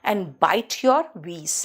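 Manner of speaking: kiss your W's and bite your V's said very slowly; slow teacher rhyme; slow saying. Shown as a demonstration. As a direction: slowly recite the rule. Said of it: "कहते हैं".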